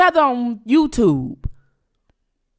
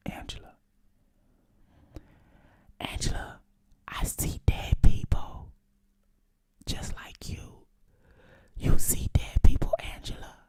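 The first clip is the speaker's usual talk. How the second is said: eerie whisper; 12 dB quieter than usual. Pretend. Taunting Angela about seeing dead people, eerie whisper. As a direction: whisper it spookily, drawing out each word.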